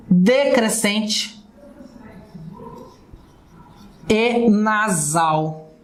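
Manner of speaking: each word drawn out for emphasis; teacher stressing the key terms slowly; slow, emphatic. Shown as a demonstration. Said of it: "listing diphthong traits".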